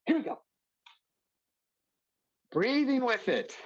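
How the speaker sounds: very high, pushy coaching voice; Siddha's voice. Retold or imitated. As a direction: egg them on in a squeaky high voice. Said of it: "I'm not doing a very good Siddha".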